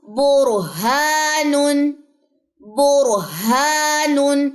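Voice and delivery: برہانن stretched very slowly, ra kept thick; heavy sakin ra. Shown as a demonstration. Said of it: "کیسے ہوگا؟".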